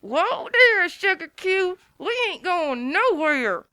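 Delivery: through clenched teeth. Acted. Applejack holding Pinkie back by her tail, through clenched teeth. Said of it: "Applejack spit out through clenched teeth".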